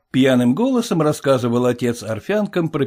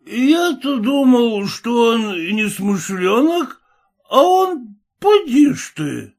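high, slow tipsy wonder; drunken father; 11 semitones higher, slower than usual. Acted. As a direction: marvel like a tearful drunk.

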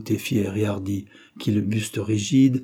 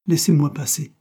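higher-pitched, understated disgust; disgusted dismissal; 6 semitones higher, 4 dB louder than usual. A narrator's reading. Girl rebuffing the man blocking her path, disgusted dismissal. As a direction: push him away with mild distaste. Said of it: "dit-elle en détournant la tête avec dégoût".

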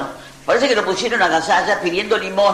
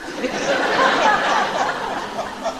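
big laugh. Real, not acted. Laughing at the punchline.

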